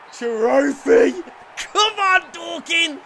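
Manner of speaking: very loud, high roar of celebration; triumphant shout. Real, not acted. Gamer cheering his club, roaring high and loud. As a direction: bellow it like the trophy is won. Celebrating imagined trophy win.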